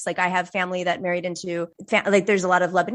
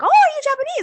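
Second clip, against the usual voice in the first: much higher, bright mock question; curious Japanese stranger; 4 dB louder than usual. Japanese people asking [name], high mock voice. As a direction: play a surprised local asking it. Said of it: "Japanese people saying to me".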